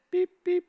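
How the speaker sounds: high, bright beeping noise imitating a watch alarm; mock beep. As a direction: chirp it like a little timer going off.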